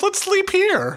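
squeaky high voice; high-pitched character voice. Pretend. Voicing Claus in the story.

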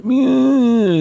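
very high, loud mock cat-like moan; hammed-up wailing meow; imitated moan. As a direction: squeal it like a pained old man. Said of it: "just going".

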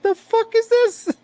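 hammed-up outrage, high and drawn out; mock outraged disbelief. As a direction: act out being stunned by the order, pitch way up. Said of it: "I'm like".

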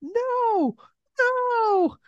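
high-pitched mock yell; yelling Nels. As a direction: squeal it way up high. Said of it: "Nels is yelling".

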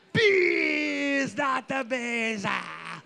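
high drawn-out mock scream about bees; hammy movie meltdown, long stretched vowels; Nick in The Wicker Man. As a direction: wail it out in hammed-up agony, trailing off. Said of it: "All right, Nick".

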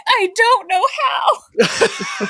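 high, drawn-out wail of bewilderment; hammed-up clueless cry, stretched very slow; helpless confusion. Imitating a dazed friend asked for shoes, exaggerated helpless wail. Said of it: "was you just going".

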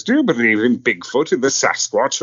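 lightly voiced theatrical storyteller; Brian Blessed impression. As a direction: play the grand actor asking with relish. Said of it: "he says".

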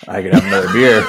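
put-on jokingly loud drunk voice; mock drunk boast. S1 jokes as drunk self.

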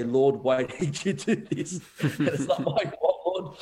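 slow, chuckling mock protest to God; slow, amused mock lament; laughing mock complaint. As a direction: laugh through a pretend grumble. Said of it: "then she can only ever say".

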